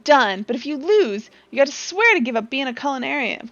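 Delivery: a touch of cocky triumph; curt rival. Acted. Rival chef accepting the wager, curt. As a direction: seal the deal coldly.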